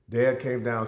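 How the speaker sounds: ordinary talk, nothing marked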